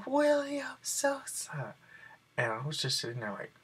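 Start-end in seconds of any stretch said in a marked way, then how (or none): 0.1-1.8 high, slow, drawn-out name call; announcer calling a name. Retold or imitated. Lottery host calls out the winning name, high and slow. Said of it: "they said".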